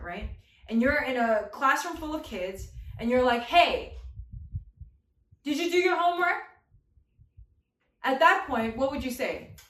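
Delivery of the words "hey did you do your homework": teacher voice. Pretend. Teacher asking kid about homework.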